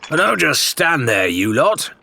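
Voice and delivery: a sharp, high, slowed bark at the crew; impatient order to idle crewmates; snapping at the crew. A narrator's reading. Ramsay snaps at the crew to stop standing around. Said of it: "he snapped".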